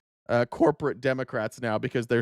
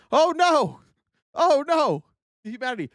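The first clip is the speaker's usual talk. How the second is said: mock horror; 12 semitones higher than usual.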